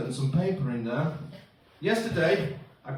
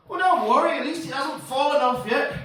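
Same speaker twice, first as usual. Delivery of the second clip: high, loud upbeat reassurance; breezy consoler; 9 semitones higher, 4 dB louder than usual. Acted. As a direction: wave the worry away, high and loud. Consoling friend in acted dialogue.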